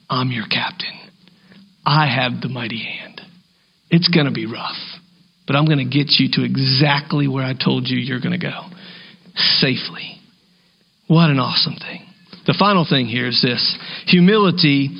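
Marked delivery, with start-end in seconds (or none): 0.1-10.2 soft, low, steady reassurance; calm reassuring voice. Pretend. God speaks to believers as captain, quiet and reassuring. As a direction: promise safety gently, partly whispered. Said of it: "This is God's voice saying".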